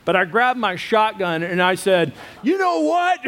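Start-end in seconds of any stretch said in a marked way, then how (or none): 2.4-3.2 much higher, dramatic defiant cry; mock angry outburst. Husband storming off with a shotgun, hammed-up much higher. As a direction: throw the line out as a hammed-up threat. Grabbing shotgun during fight.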